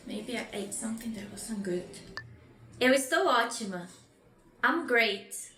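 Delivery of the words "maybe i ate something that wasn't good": hushed aside, voice dropped to a whisper; whispered, as if a little queasy. Acted. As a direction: confide it under your breath. Feeling sick in role-play dialogue.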